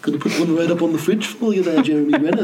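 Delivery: high, laughing hammed-up Boston accent; a laughing attempt at a Boston accent; mock Boston accent. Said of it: "I can't do accents".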